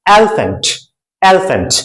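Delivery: एलिफेंट as "elfent", e swallowed; model pronunciation. Shown as a demonstration. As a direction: repeat एलिफेंट clearly for the class. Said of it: "इस word को pronounce किया जाएगा".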